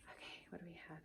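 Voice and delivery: soft whispered peek; curious whisper. Real, not acted. Vlogger whispers to viewers while opening a gift. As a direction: whisper as you lift the lid.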